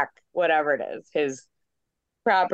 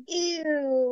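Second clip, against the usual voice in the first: mock disgust; 8 semitones higher than usual. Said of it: "they're all like".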